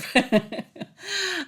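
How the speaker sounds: short high giggle; laughing. Real, not acted. Host laughing at finally doing this recording, high giggle. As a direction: burst into a high, falling laugh. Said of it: "And I'm laughing because".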